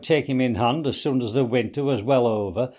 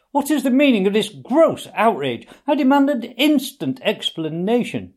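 high, loud indignant protest; 10 semitones higher, 5 dB louder than usual. A narrator's reading. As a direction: bluster with outrage. Toad protests outrage.

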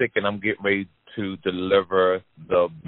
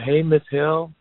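raised friendly greeting; 9 semitones higher than usual. Retold or imitated. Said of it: "I said".

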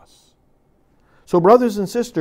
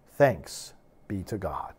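whispered response; 10 semitones lower, 10 dB quieter, slower than usual. Real, not acted. Quiet liturgical response.

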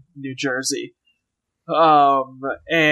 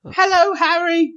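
high squeaky put-on British greeting; mock Harry Potter voice; 14 semitones higher than usual. Mocking Katie's bad voices, high and squeaky. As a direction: chirp it in a silly English voice.

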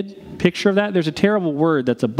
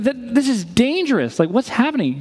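alarmed teacher; 7 semitones higher than usual. Retold or imitated. Teacher scolding Ruby in retelling.

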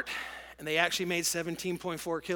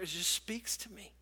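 soft whisper; 8 dB quieter than usual. Real, not acted. Moved preacher describing God's word.

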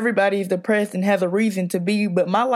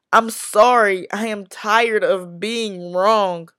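louder and louder, near shouting; rising anguish; 3 dB louder than usual. Acted. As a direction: let the self-blame build into a cry. Escalating self-blame in poem.

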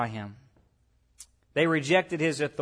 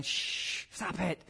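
soft, sharp shush; quiet acted scolding with a long shh; 9 dB quieter, much slower than usual. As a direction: hush the crowd under your breath. Pharisees shushing the singing crowd.

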